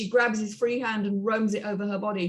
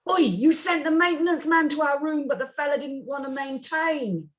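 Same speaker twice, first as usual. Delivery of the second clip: a higher, cheeky Cockney caller; British caller voice; 6 semitones higher than usual. Acted. Narrator voicing the Brit guest on the speakerphone, cheeky Cockney. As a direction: play the pushy Brit on the phone.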